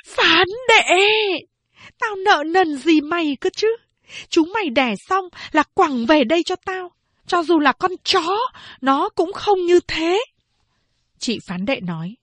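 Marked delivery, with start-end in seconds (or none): 0.1-10.2 high, loud furious scream; furious yelling. A narrator's reading. Angry mother screaming at Phán Đệ. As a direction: scream your grievance at her. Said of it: "Mẹ tôi giật dữ gào lên".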